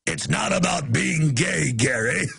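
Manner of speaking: lightly put-on film character, drawn out; slow mock earnest line to Gary; Team America impression. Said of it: "they're putting up a Team America".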